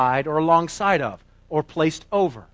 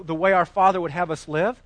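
normal talking voice

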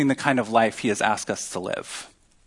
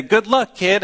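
higher and brighter mock send-off, careless; breezy brush-off; 6 semitones higher, 3 dB louder than usual. Preacher mocking a careless send-off, breezy. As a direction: toss it off like a careless boss.

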